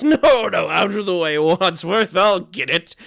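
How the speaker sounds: high loud hurried calling; calling through the door. Acted. Host shouting at Wadsworth behind the door. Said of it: "voice from beyond the door that says".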